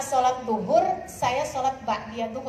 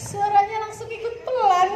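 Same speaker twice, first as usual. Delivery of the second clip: laughing; 9 semitones higher, 4 dB louder than usual. Real, not acted. Amused by the story's outcome.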